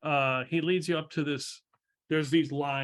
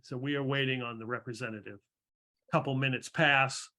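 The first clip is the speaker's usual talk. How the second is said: normal talking voice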